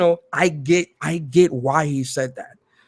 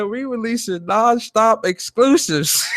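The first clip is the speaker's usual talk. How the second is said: higher mock-proud exec line, stress on nonstop; mock hype quote; 8 semitones higher than usual. Streamer repeats the exec's nonstop exclusives line, higher and mocking. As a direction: repeat the promise in disbelief, pitched up. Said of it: "Yo, my man said".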